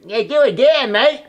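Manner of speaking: high, loud New Zealand director voice calling "do it again, mate"; Kiwi accent. Retold or imitated. Director calling for another take, in a Kiwi accent. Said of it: "in his Kiwi accent".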